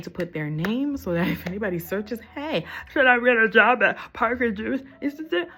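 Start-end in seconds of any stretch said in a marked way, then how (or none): 2.3-5.5 mock query.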